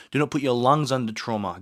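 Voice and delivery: normal talking voice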